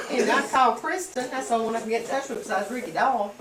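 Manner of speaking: high, loud, excited storytelling; excited retelling. Real, not acted. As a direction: rush it out, all worked up. Excited retelling after laugh.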